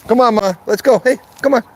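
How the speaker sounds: urging his dog Mama to keep walking; calling the dog. Real, not acted.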